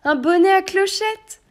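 the elf crowing the detail with delight; high loud gleeful clarification; excited correction. Acted. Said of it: "précisa le lutin".

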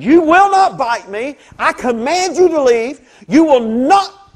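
loud, high, slow command; commanding rebuke. Retold or imitated. Preacher rebuking the biting dog, loud and slow. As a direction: order the dog away with full authority. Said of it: "I sat there and I said".

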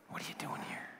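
soft intimate whisper. Shown as a demonstration. God whispering to the listener, whisper. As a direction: breathe the question right in their ear. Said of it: "You ever heard that whisper before?".

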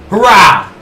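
loud, high hurrah; big victory cry; triumphant cheer. Real, not acted. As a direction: shout it out in celebration. Celebrates getting the Juggernaut perk.

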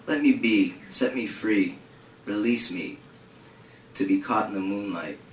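measured, low recital with spaced phrases; poetry reading. Acted. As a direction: read it gravely, line by line. Reads a poem aloud.

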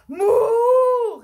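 very loud, high moo; long high cow call. A narrator's reading. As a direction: bellow like an alarmed herd. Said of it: "riefen alle Kühe am Gatter".

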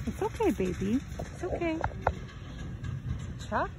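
soothing a scared dog. Real, not acted. Comforting dog scared of truck.

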